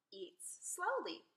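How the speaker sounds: slow, word-by-word note-taking; writing it down. A narrator's reading. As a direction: say it slowly as if jotting it down. Jack writing notes, read slowly.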